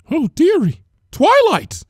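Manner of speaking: high, loud motherly welcome; cheery greeting. A narrator's reading. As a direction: call out brightly as a baker. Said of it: "Missus Cake greeted her".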